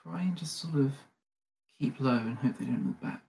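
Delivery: resigned, hushed sigh of a plan. Acted. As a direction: say it quietly, as if with a sigh. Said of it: "He kinda, like, sighs and says".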